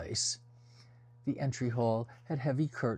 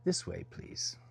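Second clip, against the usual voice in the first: hushed; 5 semitones lower, 3 dB quieter than usual. A narrator's reading. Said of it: "purring cat".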